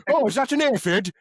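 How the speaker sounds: mock curious old man. Mocking Pete on floor.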